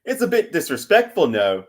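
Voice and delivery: high-pitched British tut-tutting; mock British parent. British parent objecting to son's free speech, mock British accent.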